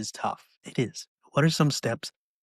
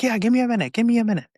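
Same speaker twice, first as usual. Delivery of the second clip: much higher, louder answer; reassuring call; 12 semitones higher, 7 dB louder, much faster than usual. Retold or imitated. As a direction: promise help shouting down. Bob answers.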